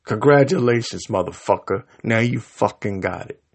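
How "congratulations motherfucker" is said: normal talking voice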